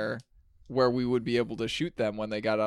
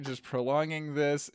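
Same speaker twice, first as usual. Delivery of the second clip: usual speaking voice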